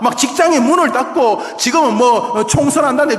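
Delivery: loud, high impassioned sermon; fervent preaching. Acted. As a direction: preach it with full fire. Preaching at full force.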